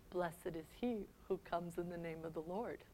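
a broken whisper; whispered blessing. Acted. Jesus lamenting Jerusalem, in a hushed whisper. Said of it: "starts to cry".

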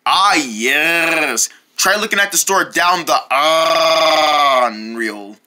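slow, drawn-out clerk with a different timbre; slow shopkeeper voice. Acted. Clerk sending the boys to the Onreal, slow and drawn out. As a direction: give directions like a sleepy old clerk. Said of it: "talking like that makes me wanna gag".

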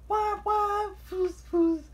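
very high wailing toot, then steam puffs; high-pitched engine noises; imitated train whistle. A narrator's reading. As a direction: mimic a steam whistle and puffing, loudly.

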